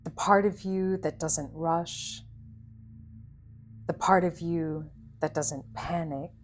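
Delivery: calm guided visualization voice with long pauses; unhurried, soothing instructions, leaving space after each phrase; guided meditation. Acted. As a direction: gently lead a listener into stillness, phrase by phrase.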